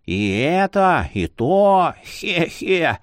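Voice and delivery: lightly voiced jolly old man; a creaky cheerful old scholar.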